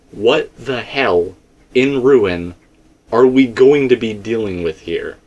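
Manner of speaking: ordinary delivery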